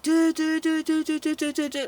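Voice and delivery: high, monotone run of buzzer noises; imitated buzzers. All the buzzers went off, imitated buzzer noise. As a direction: mimic alarms all going off at once. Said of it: "all the buzzers went off, all of them, like".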